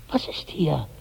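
breathy whispered question. Acted. Amran whispers, uneasy about Karnak, hushed. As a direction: whisper it, sensing something wrong.

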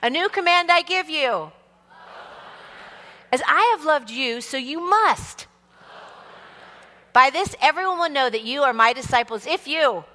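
high, projected congregational recitation; responsive church reading. Shown as a demonstration. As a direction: lead the congregation, lift the end for their reply. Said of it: "take you back to a different church".

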